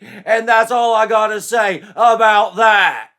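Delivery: loud, high, blustering champion; booming jock boast. Acted. Joshua vowing to protect Betsy, booming and boastful. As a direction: boom it like a wrestler's promo.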